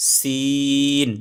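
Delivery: drawn-out sheen demo; সিন stretched long as a model; letter name demo. Shown as a demonstration. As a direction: say সিন with a long held vowel, clearly. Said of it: "সিন ইয়া নুন".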